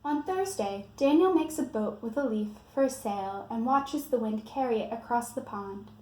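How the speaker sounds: usual speaking voice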